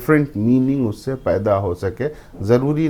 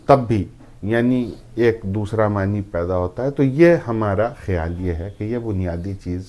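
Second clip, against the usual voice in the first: normal talking voice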